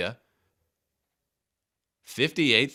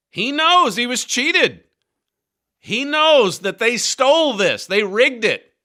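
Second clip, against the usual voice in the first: roaring rallying speech; 11 semitones higher, 7 dB louder than usual. Real, not acted. Host rallying for Trump.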